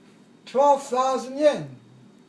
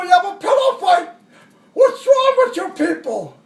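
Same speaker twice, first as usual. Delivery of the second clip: higher, louder scolding officer voice; angry colonel; 4 semitones higher, 6 dB louder than usual. Retold or imitated. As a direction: dress down the troops like a furious colonel. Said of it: "this colonel looked around and says".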